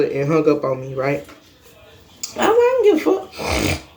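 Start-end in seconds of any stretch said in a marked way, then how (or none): 2.5-4.0 mock dismissive. Retelling her dismissive reply.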